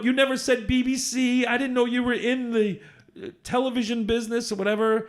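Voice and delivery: pleading she never said it; exasperated explaining. Retold or imitated.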